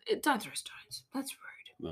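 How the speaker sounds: unvoiced mock-scolding whisper. Real, not acted. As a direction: whisper a gentle telling-off. Scolding aside about stones.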